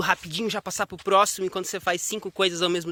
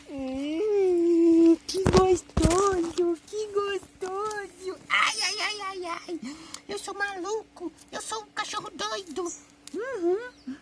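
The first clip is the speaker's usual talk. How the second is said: put-on very high, drawn-out baby voice; playful dog's voice; 12 semitones higher, much slower than usual. Dá voz ao cachorro recebendo carinho. (vozinha aguda de cachorro mimado)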